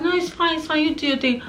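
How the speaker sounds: high, light voice playing the easygoing husband; imitated reassuring husband. Husband excusing her burps, imitated high-pitched reassurance.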